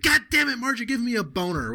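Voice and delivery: high-pitched mock exasperation, easing down; flustered outburst. Caller replying to Marge's sexy voice, flustered outburst. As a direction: burst out as if helplessly flustered.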